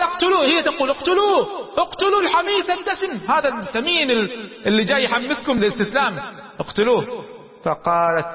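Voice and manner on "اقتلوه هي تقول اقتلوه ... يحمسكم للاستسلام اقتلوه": incite the crowd against him; furious demand. Retold or imitated. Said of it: "قالت قولة شديدة على زوجها".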